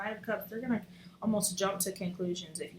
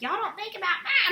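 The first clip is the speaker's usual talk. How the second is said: high-pitched mock scolding; mock reprimand; 11 semitones higher, 4 dB louder than usual. Reader showing fire signs what she doesn't mean, mock reprimand. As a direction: scold them in jest, pitched way up. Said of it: "I'm not, like, reprimanding you".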